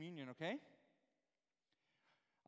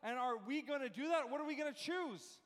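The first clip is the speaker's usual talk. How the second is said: excited, rising preacher's urgency; 6 semitones higher, 3 dB louder than usual. Real, not acted. Preacher urging the church to choose victory, excited. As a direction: urge them on with mounting energy. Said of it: "I'm getting excited".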